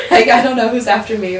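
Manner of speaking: laughing at his paranoia. Real, not acted.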